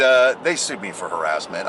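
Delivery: ordinary talk, nothing marked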